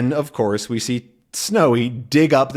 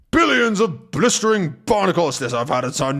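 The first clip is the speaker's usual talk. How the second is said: angry captain; 5 semitones higher than usual. Acted. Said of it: "is fuming".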